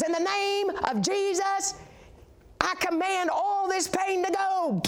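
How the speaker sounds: high, slow, stretched command; commanding healing preacher. Retold or imitated. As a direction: imitate a revival preacher ordering pain out. Imitates preacher commanding pain away.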